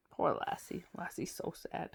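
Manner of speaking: soft breathy whisper of pity; whispered aside. A narrator's reading. Reader aside about the sad dog, pitying whisper. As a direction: whisper sympathy to the listener.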